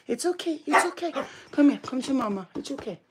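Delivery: high gentle reassurance. Real, not acted. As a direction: coo to calm her. Soothing scared dog.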